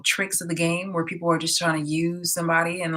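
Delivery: ordinary talk, nothing marked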